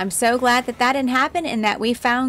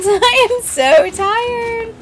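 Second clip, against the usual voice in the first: laughing tired; 11 semitones higher, 9 dB louder, much slower than usual. Real, not acted. After hours of hunting.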